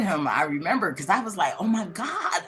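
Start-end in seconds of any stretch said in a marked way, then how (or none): 1.6-2.4 mildly panicked retelling, pitched up; retold scared gasp of words; a touch of alarm. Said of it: "I was so scared".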